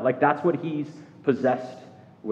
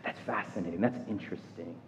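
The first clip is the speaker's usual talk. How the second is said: much softer and lower, musing; curious wonder; 5 semitones lower, 12 dB quieter than usual. Retold or imitated. Reader of scripture quietly intrigued, soft low musing. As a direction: murmur it to yourself, intrigued. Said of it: "You're like".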